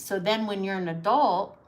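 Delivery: normal talking voice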